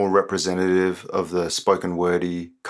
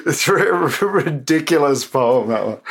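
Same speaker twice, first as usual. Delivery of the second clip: laughing; 6 semitones higher than usual. Real, not acted. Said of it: "laughs about poem".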